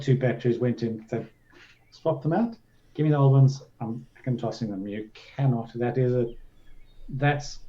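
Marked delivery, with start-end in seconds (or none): none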